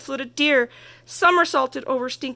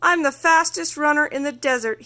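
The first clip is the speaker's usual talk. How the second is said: higher, louder boast; bragging; 5 semitones higher, 4 dB louder than usual. Acted. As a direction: show off to the tortoise. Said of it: "he bragged".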